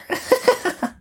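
very high character giggle; high girlish cackle. Acted. Robber girl mocks Gerda's big mittens, cackling. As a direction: cackle up high as the little bandit.

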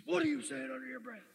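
loud, high mock demand; imitating angry boss. Boss confronting employee praying under his breath, imitated angry demand. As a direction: challenge him loudly, like a suspicious boss. Boss challenges him as he leaves.

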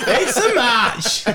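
high squealing laugh through words. Acted. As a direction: shriek it while laughing. Echoes Tinder match laughing.

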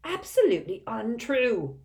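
high, wheezy indignant denial; wheezing. A narrator's reading. Doge insisting the gossip is untrue, wheezing. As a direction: wheeze it out in outraged protest. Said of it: "wheezed Doge".

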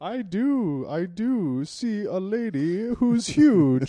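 high, loud, slow barker call; carnival barker vows. Acted. Groom barking his wedding vows. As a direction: bellow the vows like a fairground barker.

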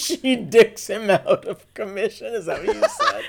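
high, giggly teasing; real laugh over the words. Real, not acted. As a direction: crack up while asking. Laughing at misheard phrase.

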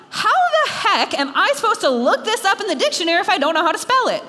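indignant, lively retold complaint; exasperated kid. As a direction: retell it as a baffled kid protesting. Child's exasperated comeback to parents.

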